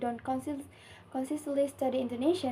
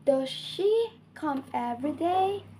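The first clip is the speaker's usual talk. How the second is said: yes-no question rising to "day"; rising intonation; 2 semitones higher than usual. Shown as a demonstration. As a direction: pitch climbs on "day". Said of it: "pitch of the voice goes up".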